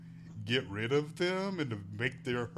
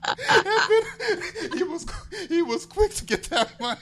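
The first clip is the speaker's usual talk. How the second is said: laughing; 8 dB louder than usual. Real, not acted. Still laughing at the scene.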